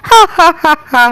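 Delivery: mocking villain's ha-ha-ha laugh; gloating laugh. Stepsister gloats to her mother about driving Rupa out, mocking laugh. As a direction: cackle in triumph at the runaway girl.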